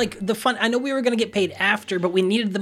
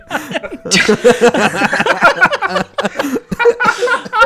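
loud laugh; 12 semitones higher, 8 dB louder than usual. Real, not acted. Big laugh at puppet frequency.

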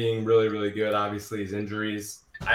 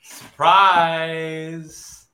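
playful exclamation; 5 semitones higher, 8 dB louder than usual. Pretend. Teasing reply to "surprise us.

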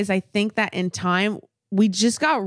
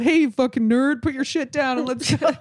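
mock rough tease; 2 semitones higher, 3 dB louder than usual. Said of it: "That's how I am".

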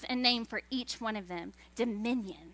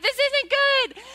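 very high, slow, loud "This isn't good"; screeching God; 12 semitones higher, 5 dB louder, slower than usual. Retold or imitated. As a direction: stretch it out in a shrill mock wail. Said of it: "He's like".

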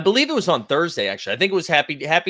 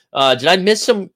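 ordinary talk, nothing marked

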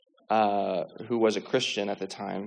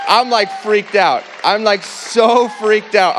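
loud, high and slow with shock; freaked out; 10 semitones higher, 9 dB louder, slower than usual. Real, not acted. Reliving shock of the healing.

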